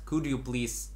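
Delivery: ordinary talk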